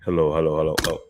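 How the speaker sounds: testing a deep voice changer; toy echo microphone. Real, not acted. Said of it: "it makes my voice deep".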